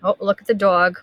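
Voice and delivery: ordinary delivery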